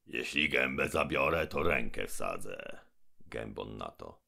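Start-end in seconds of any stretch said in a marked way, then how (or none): 0.1-2.8 menacing giant mouth. Acted. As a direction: boom down from above. Gębon threatens.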